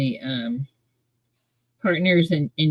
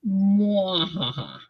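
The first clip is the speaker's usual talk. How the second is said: slow sneering villain laugh; evil laugh; 4 dB quieter, much slower than usual. Shown as a demonstration. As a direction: cackle it out like a panto villain. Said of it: "it becomes evil".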